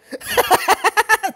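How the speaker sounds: high burst of laughter; loud laughter. Real, not acted. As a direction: break into laughing again. Laughs again.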